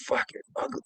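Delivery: hushed, disgusted aside; whispered disgust. Retold or imitated. Staring at the dog, whispered disgust. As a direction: breathe it out in disgust.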